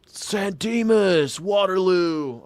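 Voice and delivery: high, slow drawn-out chant; movie-quote call. Retold or imitated. Singing out film quote.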